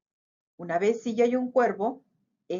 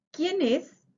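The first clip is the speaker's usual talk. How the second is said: small raised mouse voice, pitched higher; little mouse calling out; 7 semitones higher than usual. A narrator's reading. Mouse calls out in the dark cave, lightly raised. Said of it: "grita el ratón".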